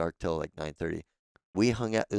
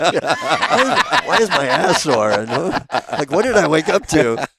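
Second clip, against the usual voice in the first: much higher, loud, laughing mock bewilderment; mock confusion; 9 semitones higher, 12 dB louder, slower than usual. Joking about the soldier waking up, laughing in a high mock voice. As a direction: squeal the questions through laughter.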